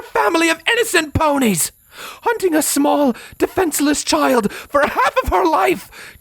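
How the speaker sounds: high, screaming rant; impassioned, high-pitched shouted accusations; furious tirade. Acted. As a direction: rage at your enemy without letting up. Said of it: "When Scarlet's tirade came to an end".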